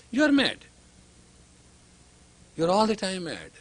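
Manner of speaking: ordinary delivery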